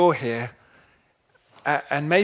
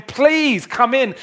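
higher, slow, tender call of please come in; voice of heaven; 8 semitones higher, slower than usual. Acted. Heaven inviting the listener into the party, slow and tender. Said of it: "Can you hear the voice of heaven saying".

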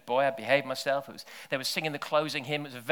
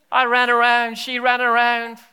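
higher, louder, slowed-down exclamation; excited; 10 semitones higher, 12 dB louder than usual. Retold or imitated. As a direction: relive the chase with glee. Excited retelling of running.

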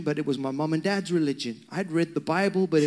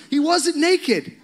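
high, loud, slow exclamation; emphatic correction; 11 semitones higher, 5 dB louder, slower than usual. Real, not acted. Preacher correcting the congregation about David's clothes, emphatic exclamation. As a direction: cry out the correction, half laughing. Preacher refuting the naked myth.

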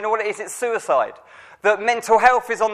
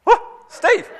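much higher mock yelp of surprise; surprised dad; 4 dB louder than usual. Dad seeing his son up at five, mock surprised whoop. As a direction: imitate dad startled to see him up early. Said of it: "my dad went".

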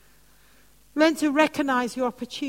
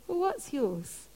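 tender reply; 3 semitones higher, 8 dB quieter, slower than usual. Retold or imitated. Repeating gentle reply.

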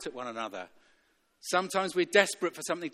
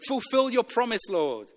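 raised, high urgent plea; calling out to God; 7 semitones higher, 6 dB louder than usual. Acted. Abraham calling on the name of the Lord, urgent plea. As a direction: plead loudly with nowhere else to turn. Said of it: "that's what Abraham was calling out".

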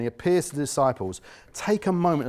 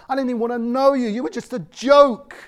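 usual speaking voice

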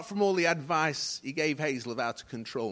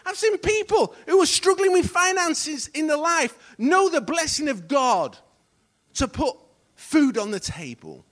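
impassioned preaching; 9 semitones higher, 9 dB louder than usual. Real, not acted. Preacher telling congregation of lives restored, impassioned preaching. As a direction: build to loud, high-pitched testimony. Preaches testimonies with rising force.

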